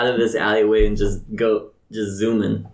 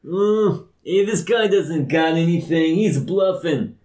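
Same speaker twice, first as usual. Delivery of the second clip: raised, slower accusation; high accusing NPC; 7 semitones higher, slower than usual. Acted. Bystander accuses the merchant of bluffing, pitched up. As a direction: call him out, pitched up.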